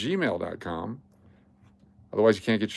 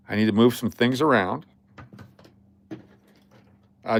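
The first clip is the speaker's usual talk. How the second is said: ordinary talk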